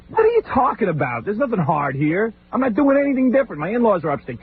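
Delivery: high, exasperated rant. Retold or imitated. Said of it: "And it's just like".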